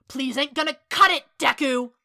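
loud, high angry outburst; furious character shout; crackling anger. A narrator's reading. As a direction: explode at someone who won't be believed. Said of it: "Kacchan's voice crackled through the air".

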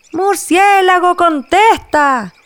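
drawn-out high shout; shouting together. Acted. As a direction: yell at the stubborn bat.

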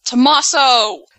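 drawn-out call. Real, not acted. Calling out wrestler's name.

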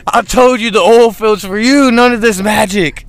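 a son mimicking his disappointed dad, loud and high; mock dad scolding. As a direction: bark at your son like a fed-up dad. Voicing dad scolding him.

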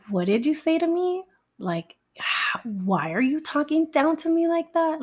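high, incredulous question rising at the end; hurt disbelief. Retold or imitated. Woman recalling her reaction to his insults, high and incredulous. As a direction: pitch it up high, stunned at being talked down to.

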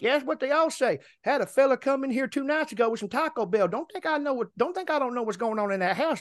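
high, knowing put-on voice; nosy neighbor voice. Neighbor tells driver of Taco Bell visitor, high knowing imitation. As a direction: imitate the neighbor airing his suspicions. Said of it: "He goes".

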